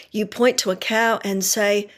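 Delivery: normal talking voice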